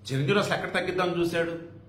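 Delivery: normal talking voice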